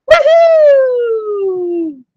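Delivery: loud, high whoop of joy; woohoo cheer. Real, not acted. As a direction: let out a big happy whoop. Cheering for independence.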